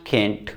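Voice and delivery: "कैंट" with a flat short e-like vowel, American style; American "can't". Shown as a demonstration. Teacher demos American "कैंट" for students. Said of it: "British और American का थोड़ा सा pronunciation difference है".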